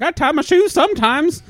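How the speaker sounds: very high, loud, dopey contestant voice; Uncle Ted. Acted. Clueless uncle answering the game-show host, high hammed-up blurt. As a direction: blurt out a silly answer in a squeaky voice.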